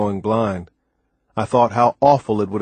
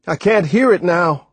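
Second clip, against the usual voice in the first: higher-pitched worried call; alarmed; 8 semitones higher, 4 dB louder than usual. A narrator's reading. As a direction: report it with rising alarm. Losing the sound of rescue.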